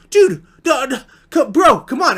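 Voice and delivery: high, loud sputtering plea; hammed-up pleading; mock protest. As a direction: stammer in disbelief, imitating a needy friend. Friend protests being sent away.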